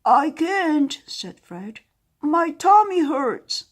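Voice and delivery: high, loud slow whine; whining sore dragon. Acted. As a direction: complain like a sick child. Fred with a sore tummy.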